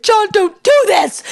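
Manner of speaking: high, slow, desperate call; pleading cry. Retold or imitated. Wife calling up to John as he leaves, high pleading wail. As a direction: wail it after him up the stairs. Calling after husband going upstairs.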